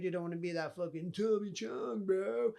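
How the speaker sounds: jokey dude-bro jab; half-laughing warning to himself; mock stoner warning. Real, not acted. Mocking stoner warning, louder.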